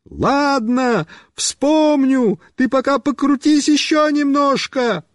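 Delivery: high-pitched shouted reply; high call; calling back. A narrator's reading. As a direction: holler it across to a moving rider. Friend shouts back to rider.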